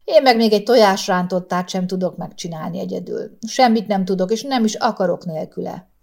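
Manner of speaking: ordinary delivery, nothing marked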